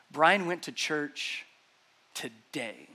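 "today" set apart after a pause, slow and low; stress on today. Shown as a demonstration. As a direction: hold back, then drop a deliberate, drawn-out "today". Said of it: "emphasize the different words differently".

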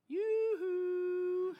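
high, sing-song call; sing-song yoo-hoo. Retold or imitated. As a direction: call out like checking if anyone is home. Calling out to God.

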